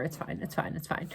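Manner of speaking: soft, breathy "it's fine, it's fine"; whispered resignation. Retold or imitated. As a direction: mutter it to yourself to stay calm. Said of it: "And so I was like".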